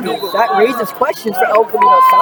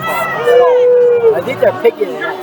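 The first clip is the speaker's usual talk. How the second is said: excited shout; 14 semitones higher, slower than usual. Real, not acted. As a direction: call it out over the crowd. Excited call about penalty.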